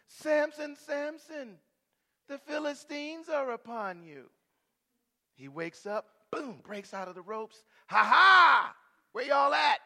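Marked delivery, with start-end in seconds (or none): 0.1-4.3 higher, loud calling of the name; Delilah calling out. Acted. 7.9-9.8 loud, higher, laughing challenge; triumphant shout. Acted. Samson voiced laughing defiantly.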